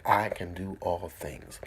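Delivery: slow, deliberate affirmation; slow declaration. Shown as a demonstration. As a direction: say it slowly like a vow. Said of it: "I want you to say".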